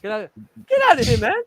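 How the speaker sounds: high, lively comic impression; protest, then honk out a staccato laugh; high-pitched indignant comedian voice; Eddie Murphy. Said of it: "Eddie Murphy?".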